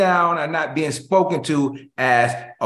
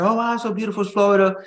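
high, gushing mock admiration; gushing admirers; 9 semitones higher, faster than usual. Preacher mocking people who praise his Florida apartment, high gush. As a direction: mimic people cooing over the place. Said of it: "and everybody says".

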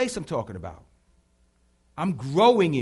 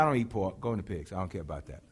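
lower, much softer, offhand; low quiet unbothered dismissal; calm dismissive reply; 11 semitones lower, 9 dB quieter, faster than usual. Retold or imitated. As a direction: brush them off quietly. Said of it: "Jesus said".

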